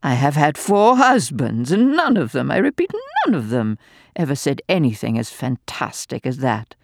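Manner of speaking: gleeful, venomous dowager mocking the doctor; venomous old lady. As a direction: taunt him with malicious glee. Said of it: "ribald guffaw".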